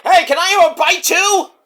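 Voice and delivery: high, eager put-on voice; Mark Bridge. Co-worker asking to share a bite, high impression. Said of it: "Mark Bridge was like".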